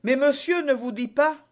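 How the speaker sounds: high, loud objection; exclamation. A narrator's reading. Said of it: "s'écria le mercier". (protestation aiguë et vive)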